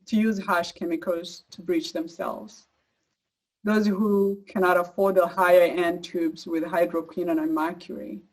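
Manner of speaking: ordinary delivery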